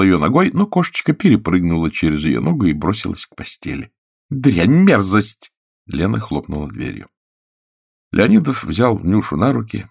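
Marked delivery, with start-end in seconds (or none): 4.3-5.5 higher, louder spat insult; disgusted anger. A narrator's reading. Actress curses the cat while storming out, disgusted. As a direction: curse the creature in loathing.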